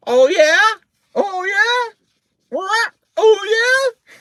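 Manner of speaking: very high, loud squawking retort; sassy parrot. A narrator's reading. Parrot mocks, retaken in character.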